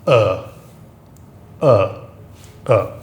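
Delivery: short falling "uh"; French œu sound. Shown as a demonstration. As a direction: demonstrate a rounded French "uh" vowel. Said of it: "this is always pronounced".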